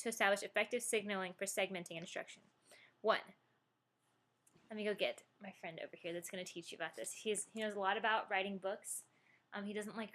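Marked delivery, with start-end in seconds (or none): none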